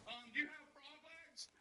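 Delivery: put-on high, slow, hesitant kid voice; a nervous little boy fumbling a prank call. Nervous kid fumbles a prank call, voice high and slow. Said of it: "I get all nervous".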